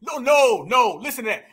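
ordinary talk, nothing marked

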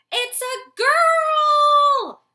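long, slow, high, loud shriek of happiness; joyful shriek. Acted. Mother owl announcing the new chick, long joyous shriek. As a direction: stretch it out into a happy screech. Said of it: "A long shriek call streamed from his mother's throat".

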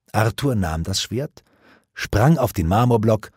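usual speaking voice

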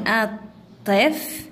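الطفل slowly as at, tif, lu; syllable by syllable. Shown as a demonstration. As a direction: split الطفل into three separate beats. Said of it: "تقطيعها".

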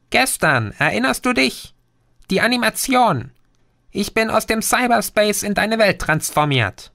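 put-on a cyber gnome calming the boy; tiny green creature. Green explains his origin. (kleines grünes Wesen, höher, beruhigend)

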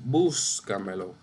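búscamelo slow, with a long first syllable BÚS; stress on BÚS. Shown as a demonstration. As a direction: stretch BÚS, drop off on camelo. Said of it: "lleva tilde ahí".

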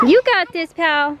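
bright encouraging call, pitch up; pep the dog up with energy. Real, not acted. Cheering the dog on.

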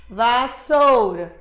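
"vassoura" lento, sílabas separadas; soletrando devagar. Shown as a demonstration. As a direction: marca cada sílaba de "vassoura". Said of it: "batendo na pauta". ("vassoura" devagar, marcando as sílabas)

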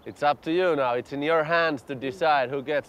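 low, grave announcement; solemn announcer voice. Acted. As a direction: speak it like a solemn ritual.

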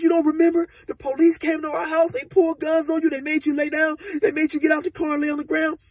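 imitating a panicked girlfriend; frantic crying. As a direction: pour it out in a panic. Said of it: "she crying and hollering".

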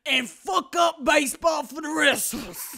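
loud, high, drawn-out southern holler; outraged good-old-boy southern drawl. Acted. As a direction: rant slowly in a hammed-up southern accent. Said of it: "I wish I had a southern drawl".

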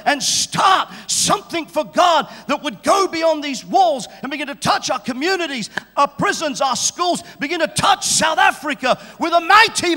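shouted prayer building to a roar; fervent prayer. Real, not acted. Preaching prayer at full force.

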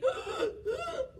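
high, airy mock wheezing; pretend wheeze. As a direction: wheeze in and out for the story.

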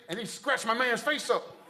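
ordinary talk, nothing marked

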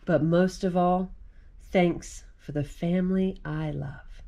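gentle read-aloud of a rhyming picture book; reading to children. Acted. Mom reading a Thanksgiving book to kids, gentle read-aloud.

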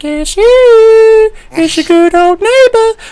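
a cartoonish high voice as a demo; high pitched voice. Shown as a demonstration. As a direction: jump way up into a silly falsetto. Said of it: "Most guys can do a higher pitched voice".

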